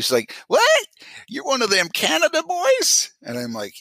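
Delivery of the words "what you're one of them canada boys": very high, squealed surprise, hammed up; astonished neighbor girl. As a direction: mimic the girl next door in a squeaky voice, rising. Imitates Southern neighbour girl's reaction.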